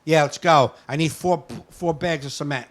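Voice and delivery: loud, bossy order to staff; entitled customer barking an order, loud; rude order. Retold or imitated. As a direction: order them around like servants. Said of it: "that's how he talked to you".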